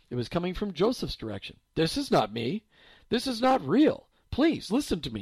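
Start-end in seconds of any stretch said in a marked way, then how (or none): 1.7-5.2 louder, insistent and firm. Acted. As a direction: plant each word firmly. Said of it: "he said firmly".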